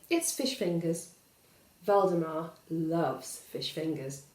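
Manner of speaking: ordinary delivery, nothing marked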